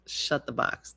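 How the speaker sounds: whispered to self. Real, not acted. Repeating the game's name under breath, whispered. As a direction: mouth the name to yourself.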